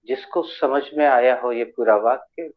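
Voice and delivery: ordinary delivery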